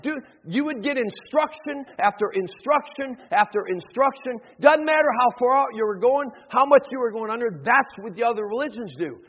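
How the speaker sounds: impassioned preacher in full flow; forceful preaching. Acted. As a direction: hammer the point home to the congregation.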